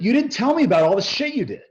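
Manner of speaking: high, loud mock yell of an angry investor; retold yell. Investor yelling at him on the phone, loud and high. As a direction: throw it back the way he shouted it. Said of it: "he yells at me".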